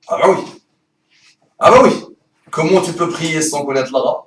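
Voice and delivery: usual speaking voice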